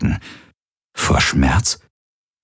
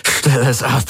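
higher, strained plea; rising desperate plea; 8 semitones higher than usual. A narrator's reading. Tormented man pleads again with the Helix, voice climbing. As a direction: voice climbing in panic. Tortured man begs noise stop.

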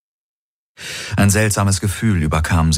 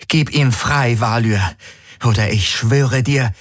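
higher, forceful threat; furious demand; 5 semitones higher than usual. Acted. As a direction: force him to give Garland back. Kirai threatens Valyr.

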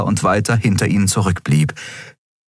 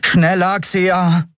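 high, pressing voice; high-pitched urgent push; urging; 11 semitones higher, much slower than usual. A narrator's reading. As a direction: urge the pilot on. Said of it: "drängte Miko".

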